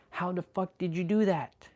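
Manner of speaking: high astonished quote; amazed people. Retold or imitated. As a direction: sound like people stunned by a trick. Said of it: "people are like".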